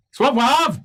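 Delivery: high, loud guard laying down the rules; guard on the wall telling the party the gates are shut, loud. Acted. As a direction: announce it from the wall like a smug guard. GM voices the guard.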